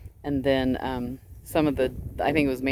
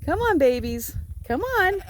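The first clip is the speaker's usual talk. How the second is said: high coaxing call, rising higher; calling the sheep; 14 semitones higher than usual. Real, not acted. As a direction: coax them over.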